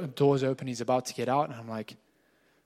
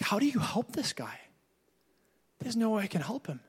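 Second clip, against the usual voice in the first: helpless thought; 7 semitones higher, 4 dB quieter than usual. Retold or imitated. Pastor's helpless inner thought.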